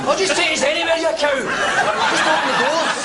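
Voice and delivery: very high, frantic angry screech; furious outburst. Acted. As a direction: lose it completely at a machine. Losing temper at the lift.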